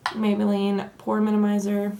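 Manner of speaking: ordinary talk, nothing marked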